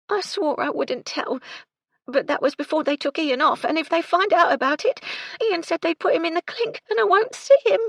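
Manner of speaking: high, loud distressed maid, near sobbing; upset young servant on the verge of sobs; tearful. A narrator's reading. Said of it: "She began to sob again".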